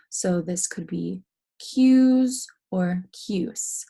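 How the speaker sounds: cues with a Z sound, then cuse with an S sound, slowly; slow side-by-side contrast of cues and cuse; two pronunciations. Shown as a demonstration. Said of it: "The thing that's changing".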